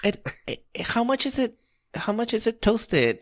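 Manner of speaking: high, hesitant imitation of an old customer; dithering old lady. Old lady puzzling over prices at the counter, high dithering voice. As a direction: stumble through it as a sweet old lady. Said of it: "a gazillion questions".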